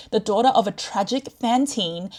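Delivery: ordinary talk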